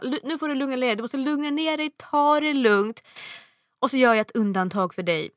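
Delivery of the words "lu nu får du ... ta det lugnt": calming agent. Retold or imitated. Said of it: "han bara".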